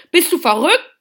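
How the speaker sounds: loud, high indignant outburst; scolding. A narrator's reading. Brother scolding Pauli for giving food away, indignant. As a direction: scold in disbelief. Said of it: "schimpfte Max".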